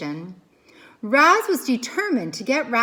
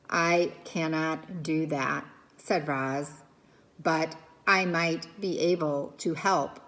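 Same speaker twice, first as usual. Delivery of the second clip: calm robot voice; 6 semitones lower, 4 dB quieter than usual. Acted. Robot answering calmly.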